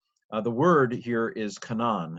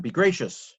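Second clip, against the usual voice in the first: commanding; 7 semitones higher, 5 dB louder than usual. Shown as a demonstration. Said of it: "but it's a command".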